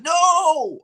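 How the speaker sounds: high loud cry; retold friend shouting no; alarmed shout. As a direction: yell it to stop him. Friend stopping him.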